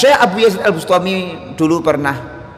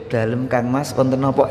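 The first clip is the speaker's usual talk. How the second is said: soft, humble kromo reply; polite Javanese wife; 3 semitones lower, 4 dB quieter than usual. Retold or imitated. Wife answering her husband in refined kromo, soft and humble. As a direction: answer your husband softly and respectfully. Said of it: "pakai basa kromo sama suami".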